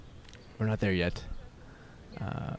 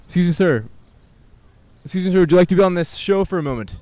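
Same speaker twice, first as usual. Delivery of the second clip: high-pitched call; 6 semitones higher, 7 dB louder, faster than usual. Real, not acted. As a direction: voice pushed up high and loud to get a stranger's attention. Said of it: "it's a little far out of my vocal range".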